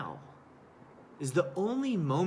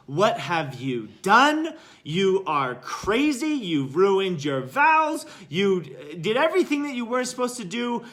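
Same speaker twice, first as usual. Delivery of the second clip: losing his mind; 6 semitones higher, 9 dB louder than usual. Acted. Monk berating the monk who carried the woman, outraged. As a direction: act out an outraged, ranting monk. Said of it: "losing his mind".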